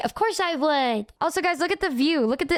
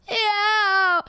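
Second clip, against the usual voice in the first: high, drawn-out fake crying whine; mock wail; 8 semitones higher than usual. Retelling being sad at the aquarium, mock wailing. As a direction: wail like a sulking child.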